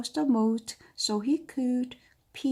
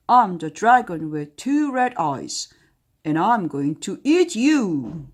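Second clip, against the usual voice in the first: dragon roar; 9 dB louder than usual. Acted. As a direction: roar it out to scare the cook. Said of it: "jumped in front of her and roared".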